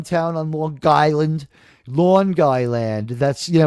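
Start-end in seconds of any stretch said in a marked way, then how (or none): 1.9-3.1 New York accent. Shown as a demonstration. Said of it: "with the New York accent".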